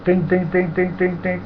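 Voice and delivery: high sing-song bell dings; imitated bell ringing. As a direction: chime it like a bus bell.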